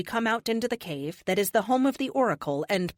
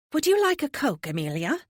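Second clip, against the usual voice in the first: lilting higher sing-song offer; 8 semitones higher, 3 dB louder than usual. Acted. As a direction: sing the question sweetly. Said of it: "Pythia's sing-song voice".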